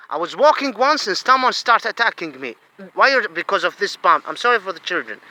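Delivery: normal talking voice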